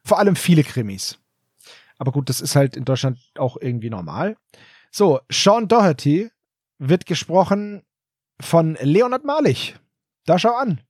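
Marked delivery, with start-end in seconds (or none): none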